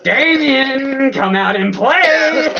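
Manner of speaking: high, slow sing-song call out to a rival; drawn-out playground taunt, come out and play. Acted. Friend taunting the enemy to come out, slow high sing-song call.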